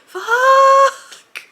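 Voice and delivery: high, loud, long-held FUCK; re-enacted labor scream. Hunched over the photocopier in labor, re-enacted scream. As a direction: wail it out, imitating a contraction scream. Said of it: "I was screaming that word of choice".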